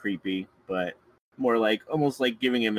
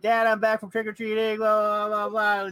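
put-on high, loud, whiny kid yelling from the window; yelling bratty kid; 12 semitones higher, 4 dB louder than usual. Kid yelling down to his dad from the upstairs window, high and loud. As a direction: shout it down like an annoying kid upstairs. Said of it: "just yelling".

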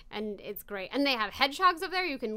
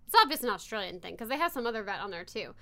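normal talking voice

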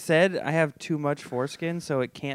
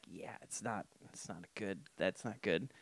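quiet; 6 semitones lower, 16 dB quieter than usual. Retold or imitated. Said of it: "And I'm like".

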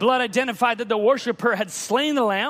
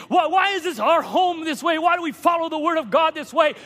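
higher-pitched, lightly voiced pleading child; insistent child questioning; 7 semitones higher than usual. Child asking Daddy and Mama about church, lightly acted insistence. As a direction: pile up the questions like a puzzled child.

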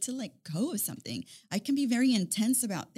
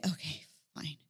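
whispered; 6 semitones lower, 8 dB quieter than usual. Retold or imitated. Retold resigned self-talk.

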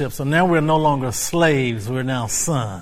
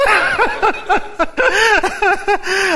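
delighted laugh. Real, not acted. Preacher laughing at his own catchphrase. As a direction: let the laugh roll.

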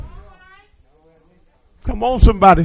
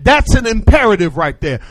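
ordinary talk

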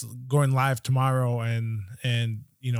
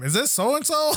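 high mock question; 13 semitones higher than usual. Ted peeking in, quoted.

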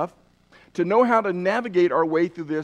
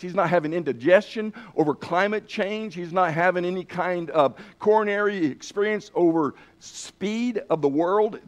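normal talking voice

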